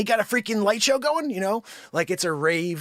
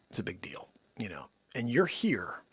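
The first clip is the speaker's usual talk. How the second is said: unvoiced, much softer aside; whisper; 8 semitones lower, 8 dB quieter than usual. Host whispering an aside to viewers, much softer. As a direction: hush it like a secret.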